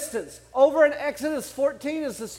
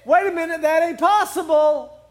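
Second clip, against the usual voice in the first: higher, louder acted protest; skeptical objection; 5 semitones higher, 5 dB louder than usual. Listener doubting the sun stood still, in a high, loud protest. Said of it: "You say".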